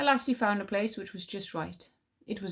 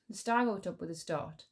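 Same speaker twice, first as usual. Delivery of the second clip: ordinary delivery, nothing marked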